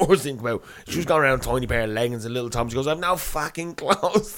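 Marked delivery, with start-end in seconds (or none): 2.9-4.3 high-pitched, drawn-out mock outrage; retold frantic complaint, pitched up; mock meltdown. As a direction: imitate someone going mental, slowly and high. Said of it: "That going mental at everybody".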